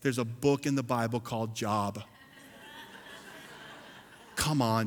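ordinary talk

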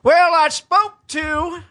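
high, slow snooty voice; mocking imitation. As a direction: mimic a name dropper. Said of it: "He's such a name dropper".

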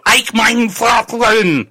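high, slow hammed-up German accent; a slow, high mock German accent. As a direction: bark it like a cartoon German soldier, slowly. German thugs bursting in.

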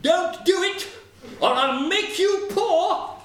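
high, loud sparrow warning; shrieked little-bird threat, ringing out; crying out. A narrator's reading. Sparrow threatening wagoner over sleeping dog, crying out. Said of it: "he cried out".

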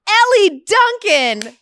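big stage announcement, building to a high, loud, stretched name; mock emcee bringing on a guest; event introducer. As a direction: crow the name out to the crowd. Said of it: "goes up to introduce me".

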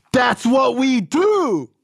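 high, drawn-out exclamation; impassioned rant. Retold or imitated. Sponsor hammering home addiction's truth to shocked caller, impassioned rant. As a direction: imitate a sponsor crying out, stretching each word. Sponsor rants in retold phone call.

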